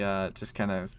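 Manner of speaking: ordinary talk, nothing marked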